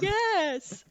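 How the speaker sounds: said through a yawn. Real, not acted. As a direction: yawn out the word. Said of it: "Sorry, I yawned".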